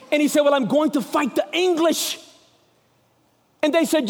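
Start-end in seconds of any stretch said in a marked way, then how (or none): 0.4-2.2 higher-pitched Scottish brogue; amateur movie-hero Scots; Scottish accent. Acted. Wallace answering his men, higher Scottish accent. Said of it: "It's not bad for a redneck".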